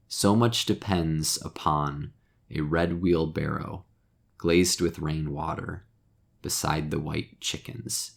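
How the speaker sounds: slow, measured reading of a short poem. Shown as a demonstration. Teacher reads the poem to the class, slow and measured. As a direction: let each line settle before the next.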